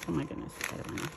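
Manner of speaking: ordinary talk, nothing marked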